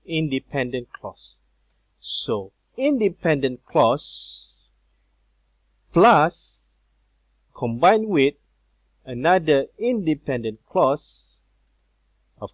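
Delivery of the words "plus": plus hit much louder and higher; stressed plus. Shown as a demonstration. Teacher hammering plus to the class, loud and high. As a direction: bang out the word plus.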